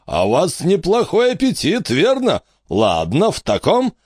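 lightly voiced greedy old tour guide; pompous showman. A narrator's reading. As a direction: hawk the battle show like a carnival barker. Wicks teases Crumley.

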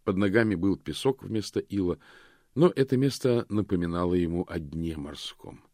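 ordinary delivery, nothing marked

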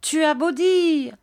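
put-on very high, taunting boys' chant; crying street boys. A narrator's reading. Neighbourhood boys invade the shop demanding gold, high taunting cries. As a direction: call out high, pestering the old man. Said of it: "en lui criant".

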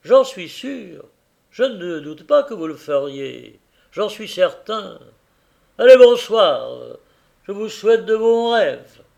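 high, loud, needling voice; sly Tackleton. A narrator's reading. Tackleton needling the carrier about his wife, high and loud. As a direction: prod the husband with mock respect.

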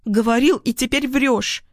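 put-on high, angry girl's cry; squealing accusation. A narrator's reading. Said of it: "визжит она". (визгливо, с обвинением)

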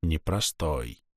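quiet aside. A narrator's reading. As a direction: murmur it to yourself. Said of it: "narrator repeats to himself".

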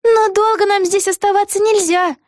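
put-on high-pitched girlish character voice; young heroine voice. A narrator's reading. Vega seeking her friend's agreement, high girlish voice. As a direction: pitch it way up, like a little girl. Vega speaks in narrated story.

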